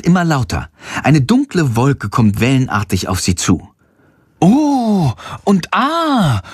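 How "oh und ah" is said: langgezogenes, hohes Staunen; staunend. Acted. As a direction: gedehnt und verwundert ausrufen. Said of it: "rufen Passanten, die staunend". (staunende Passanten rufen langsam und hoch)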